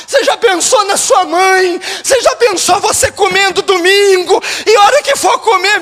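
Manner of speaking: high, loud, urgent exhortation; impassioned preaching. Real, not acted. Pastor confronting listeners about their families, impassioned preaching. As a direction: press the congregation at full force.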